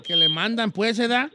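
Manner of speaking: ordinary talk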